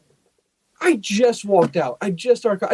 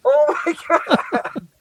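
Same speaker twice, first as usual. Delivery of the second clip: laughing disbelief; 6 semitones higher, slower than usual. Real, not acted. Laughing at the absurd answer.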